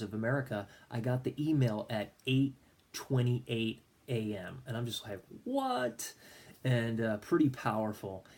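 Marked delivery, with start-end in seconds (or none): 5.4-6.1 squeaky high stunned what; a disbelieving what, way up high. Retold or imitated. Friend's invitation arrives at eight twenty-eight, stunned high what. Sees the 8:28 email time.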